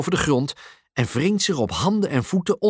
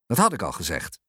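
low-pitched maternal firmness; stern; 8 semitones lower, 3 dB quieter, faster than usual. A narrator's reading. Mum reminding her son of the rule, stern. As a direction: remind firmly, voice kept down. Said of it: "zegt mama streng".

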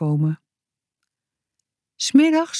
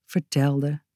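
vertelde softer and lower, trailing off; fading vertelde; 3 semitones lower, 5 dB quieter than usual. A narrator's reading. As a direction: let vertelde fade away. Repeated, fading.

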